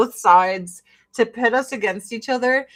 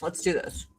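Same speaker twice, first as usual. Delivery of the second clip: butthole mouth voice; 5 semitones lower, 8 dB quieter than usual. Pretend. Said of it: "I had a butthole mouth".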